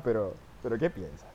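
normal talking voice

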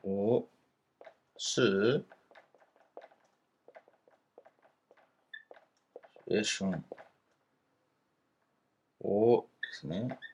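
reads 我是学生 word by word, quiet and slow; soft, slow Chinese model reading. Shown as a demonstration. Teacher softly models the sentence 是学生 syllable by syllable. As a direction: sound out each Chinese syllable gently for learners. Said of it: "こうなります".

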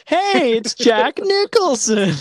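put-on high, slow, awestruck kid voice; wide-eyed infomercial sidekick. Sidekick marvelling to the host in a mock infomercial, high and slow. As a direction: gush like a naive sidekick on an eighties tape. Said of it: "I'm Danny".

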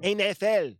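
N F L in a mock Spanish accent; joking put-on Spanish pronunciation. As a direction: imitate Spanish-accented letters. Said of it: "This is how we hear all you Spanish people say".

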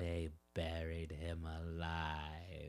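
slow, hushed mock-dramatic whisper; hammed-up eerie whisper. Podcaster retelling Nana Rose's dark secret, mock-dramatic whisper. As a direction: whisper it slowly, like a grisly reveal.

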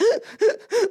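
a sharp, high gasping sob. Shown as a demonstration. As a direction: show the sudden catch of a sob. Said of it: "like, that kind of sob".

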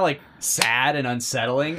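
normal talking voice

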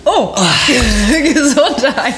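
laughing out a bless you. Real, not acted. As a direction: say it through a burst of laughter. Host laughs after co-host sneezes.

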